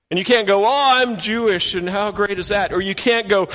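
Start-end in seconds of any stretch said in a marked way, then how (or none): 0.7-2.7 lightly hammed-up bragger; smug boast. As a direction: parody someone bragging about belonging. Mocking a boastful insider.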